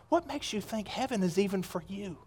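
very soft, probing question; almost under the breath, confronting; grave and barely voiced; hushed challenge. Real, not acted. Sobering challenge to congregation.

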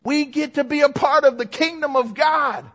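fervent pulpit cry; exultant preaching. Real, not acted. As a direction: rouse them with the privilege of it. Exultant repeat to rouse congregation.

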